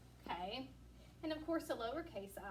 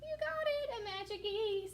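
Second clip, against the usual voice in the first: excited praise; 11 semitones higher, 5 dB louder than usual. Acted. Teacher praising unseen class.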